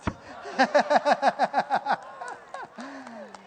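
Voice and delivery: long rapid-fire laugh; rattling run of chuckles rising at the end; laughter. Real, not acted. Amused by the sign he read.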